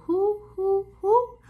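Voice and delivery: playful high hooting owl. Shown as a demonstration. Teaching children what owls sound like, playful hooting. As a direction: hoot it like an owl for the kids. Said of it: "we can know what sound they make".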